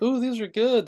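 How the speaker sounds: voiced high and bright; high girl voice. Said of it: "she's like".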